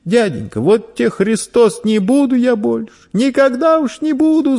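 shrill-pitched, ringing promise; ringing cry. A narrator's reading. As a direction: swear it desperately at the top of a boy's voice. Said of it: "звонко крикнул мальчик".

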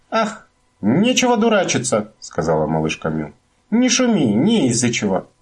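high snappy scolding. A narrator's reading. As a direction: scold him off. Little My scolds.

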